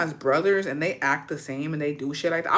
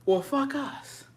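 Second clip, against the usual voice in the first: deadpan resignation; 6 semitones higher, 6 dB quieter, much slower than usual. Retold or imitated. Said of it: "I was like".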